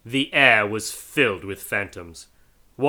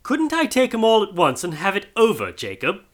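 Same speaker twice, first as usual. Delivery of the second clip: higher, coaxing suggestion; hinting Scrooge; 9 semitones higher than usual. Acted. Scrooge hinting to the ghost, coaxing. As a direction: try to wriggle out. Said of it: "hinted Scrooge".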